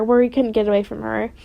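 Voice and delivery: ordinary delivery, nothing marked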